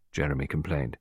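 ordinary delivery, nothing marked

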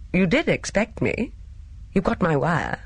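mildly doubtful, pitch swinging up; a little doubtful. A narrator's reading. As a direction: ask it with a touch of uncertainty. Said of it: "He said, a little doubtfully".

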